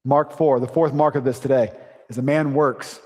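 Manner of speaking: usual speaking voice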